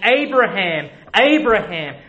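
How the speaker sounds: loud, urgent double call of "Abraham"; passionate call. Acted. The Lord stopping Abraham's sacrifice, a loud passionate call. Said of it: "great passion and emotion".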